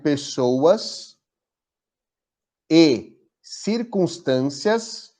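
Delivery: very slow, drawn-out, prayer-like phrasing; slow solemn affirmation. Shown as a demonstration. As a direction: spell it out with long pauses like a prayer. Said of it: "eu vou até falar devagar pra vocês anotarem". (afirmação lenta e solene, como uma oração)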